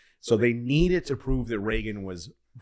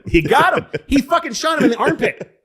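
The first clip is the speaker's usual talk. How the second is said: high laughing outburst, much louder; laughing excitedly; 7 dB louder than usual. Real, not acted. Telling a friend about the shooting, high and laughing. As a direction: squeal it through laughter. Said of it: "laughing excited retelling".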